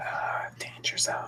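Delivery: breathy whispered warning. Pretend. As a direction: whisper it like a secret. Whispered danger zone joke.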